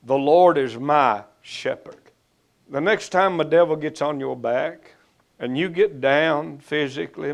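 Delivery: ordinary talk, nothing marked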